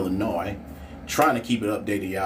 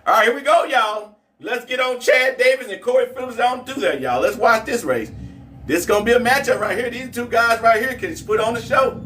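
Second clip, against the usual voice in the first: excited, higher play-by-play hype; race hype; 12 semitones higher, 4 dB louder than usual. Acted. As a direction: pump the chat up for the next race. Hypes up the drag race.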